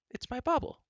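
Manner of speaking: very high princess explaining. A narrator's reading. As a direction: answer brightly in a high girlish voice. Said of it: "said Elenwy".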